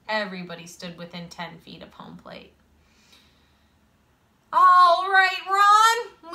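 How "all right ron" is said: loud, high, stretched-out cheer; shouted encouragement. A narrator's reading. Boy cheering on his kicking teammate, loud high shout. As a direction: holler it out to a teammate. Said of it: "Lewis shouted".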